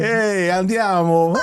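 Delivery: high, drawn-out cartoon Italian; mock Italian accent. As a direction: stretch it out like a stage Italian, voice up high. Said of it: "became Italian".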